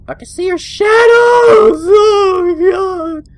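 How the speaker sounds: loud high alarmed cry; spooked by shadows; real startled yell; real fright. Real, not acted. Spotting enemies.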